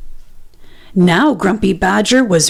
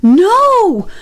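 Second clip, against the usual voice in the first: much higher, loud cry of terror; scream; 11 semitones higher, 4 dB louder than usual. A narrator's reading. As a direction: scream from a nightmare. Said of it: "screamed Grumpy Badger".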